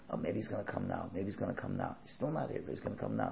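a wife quietly reassuring herself he'll come; worried wife. Retold or imitated. Wife waiting for her late husband, murmured softly.

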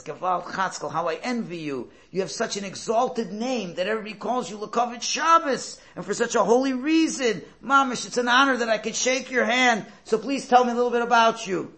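loud, high, rising wonder; awed exclamation. Retold or imitated. The rabbi marvels at the porter's name, loud and awed. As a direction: gush with reverent admiration.

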